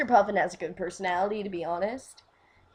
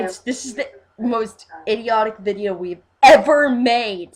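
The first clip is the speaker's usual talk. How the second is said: loud outburst, far above usual talk; really loud; 2 semitones higher, 9 dB louder than usual. Real, not acted. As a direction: get carried away and blurt it out loudly. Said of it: "Sorry, I got really loud there".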